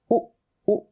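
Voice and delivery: उ clipped to a brief "u"; short isolated u, as in wood; short U sound. Shown as a demonstration. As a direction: say उ twice, each very short. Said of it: "छोटे ऊ की मात्रा".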